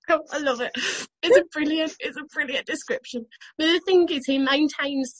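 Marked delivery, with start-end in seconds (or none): 0.0-3.2 high voice, still giggling; real laughing delight. Real, not acted. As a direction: gush while recovering from a laughing fit. Cracking up at the quote.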